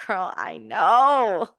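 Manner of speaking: slow, drawn-out knowing reassurance; slow knowing girl-to-girl sympathy. Retold or imitated. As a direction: draw it out like a knowing friend. Silent look of sympathy.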